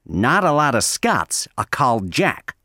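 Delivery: "Not a lot of Scots are called Jack" with every o opened to a flat a, "nat a lat"; American accent. Shown as a demonstration. Teacher showing learners how Americans say it. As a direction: turn each o into a flat American a. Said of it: "pronounce that O sound like an A".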